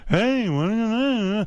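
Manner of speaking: high, whiny wordless comedian imitation; Jerry Seinfeld. Shown as a demonstration. Host showing his own inexact try, high Seinfeld impression. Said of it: "'cause I do".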